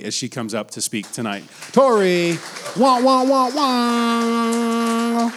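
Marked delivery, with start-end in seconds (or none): 1.7-2.4 loud excited call-out. Real, not acted. Introducing guest, calling out. 2.8-5.3 high loud honking wah; air horn noise. Pretend. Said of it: "I was trying to do the air horn".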